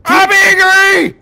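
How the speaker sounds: high, loud, slow mock-angry roar; hammed-up fury. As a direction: drag out the rage like a raging bull. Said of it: "I'm still angry".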